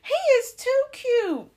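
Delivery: very high, slow, loud cooing; imitating a stranger fawning over a baby; gushing lady passerby. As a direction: gush it sky-high and stretched out. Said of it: "She was like".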